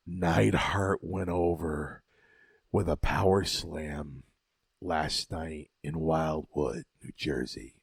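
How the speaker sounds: low, slow mock-serious announcement; imitating Sammartino. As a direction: drag it out in a deep solemn voice. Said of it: "Sammartino turns around and he goes".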